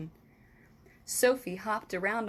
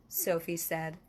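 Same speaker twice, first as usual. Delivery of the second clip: ordinary delivery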